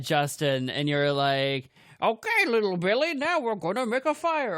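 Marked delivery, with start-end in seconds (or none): 2.0-4.6 high, put-on expert voice; wise old professor. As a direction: play the folksy fire expert. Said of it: "I was the professor expert on fire".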